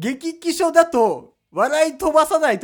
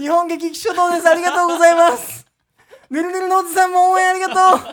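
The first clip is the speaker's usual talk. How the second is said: loud, fast thanks to supporters; rally speech; 5 semitones higher, 5 dB louder, faster than usual. Pretend. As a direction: candidate thanking the crowd. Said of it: "手踏んだ、ぬるぬるのおじさんは。".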